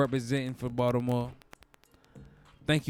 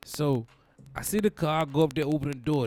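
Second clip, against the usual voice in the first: ordinary delivery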